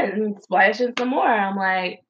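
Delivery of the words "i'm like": normal talking voice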